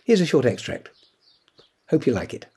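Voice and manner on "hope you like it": usual speaking voice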